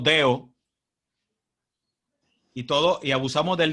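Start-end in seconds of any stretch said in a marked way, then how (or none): 0.0-0.5 "deo" said with the middle d dropped; "deo" clipped, no d between the vowels; Puerto Rican deo. Shown as a demonstration. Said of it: "no decimos dedo, decimos deo". ("deo" sin la d intervocálica)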